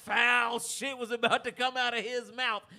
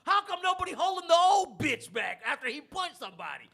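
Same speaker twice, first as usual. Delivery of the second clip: indignant shout; 6 semitones higher, 6 dB louder than usual. Retold or imitated. Retelling how the bar held him back after the punch, laughing outraged shout. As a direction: yell it like you can't believe they're holding you back.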